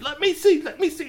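high-pitched, insistent pleading; eager demand. Acted. Kwame pushing Curtis away from the telescope, eager. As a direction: beg for a turn, high and urgent.

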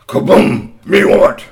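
loud breathy monster grunt; Bigfoot roar. Acted. Storyteller voicing Bigfoot demanding the lemonade with a loud grunt. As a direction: growl it like a big hairy beast. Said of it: "Strongbones said".

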